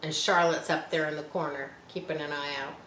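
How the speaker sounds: plain reading voice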